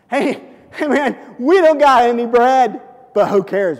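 loud, high "hey, hey, man"; carefree and upbeat. Pretend. Disciples shrugging off the missing bread, upbeat. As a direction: brush it off cheerfully. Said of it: "How should they have responded?".

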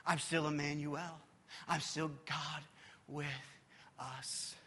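tender whispered ending; hushed promise. Acted. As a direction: breathe the promise. Jesus' quiet promise.

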